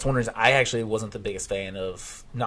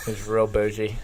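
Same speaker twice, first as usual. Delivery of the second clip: lisp. Retold or imitated. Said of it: "someone with a really bad lisp".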